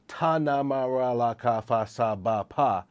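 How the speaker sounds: ta, na, ma run together as even syllables; list of sounds. Shown as a demonstration. Memory coach recalling the digit sounds, syllables run together evenly. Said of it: "look at that list of".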